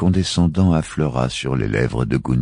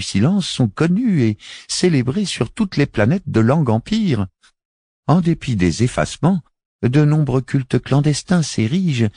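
higher, measured woman's voice; a solemn alien envoy, voiced higher; Miaït's voice; 10 semitones higher, 4 dB louder than usual. Miaït answers Chari. (voix aiguë et posée)

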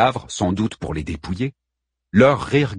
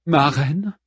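higher, questioning call to the queen; hesitant "my queen?", pitched up; Brémusa calling; 6 semitones higher than usual. A narrator's reading. Warrior trying to get her queen's attention, questioning call. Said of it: "entendit une voix".

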